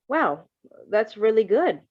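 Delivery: bright impressed praise. A narrator's reading. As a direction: sound genuinely impressed by the stitches. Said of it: "he said, impressed".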